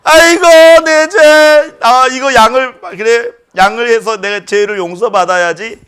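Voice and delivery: high, loud lament, easing down; wailing sinner. Retold or imitated. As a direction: groan over your sins then resolve. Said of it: "양을 한 마리 가져오는 거예요". (죄를 탄식하는 죄인 흉내)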